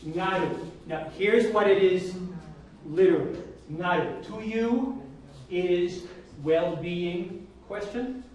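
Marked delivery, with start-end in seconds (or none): 4.2-8.2 slow, separated words of a word-for-word translation; slow literal gloss. Shown as a demonstration. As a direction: spell out the gloss piece by piece. Teacher gives literal gloss.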